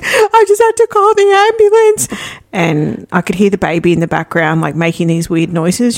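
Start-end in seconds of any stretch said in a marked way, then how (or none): 0.0-2.1 very high, tearful retold wail; imitated crying panic. Distressed sister phoning about her sick baby, imitated crying. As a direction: mimic someone sobbing out urgent news. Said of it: "And she's crying".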